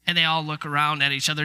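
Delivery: ordinary talk, nothing marked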